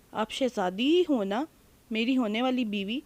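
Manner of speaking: usual speaking voice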